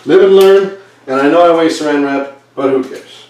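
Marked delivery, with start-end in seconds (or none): 0.1-0.8 upbeat exclamation. Real, not acted. Shrugging off wasted wrap.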